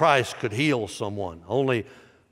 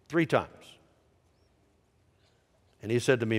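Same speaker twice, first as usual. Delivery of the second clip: ordinary talk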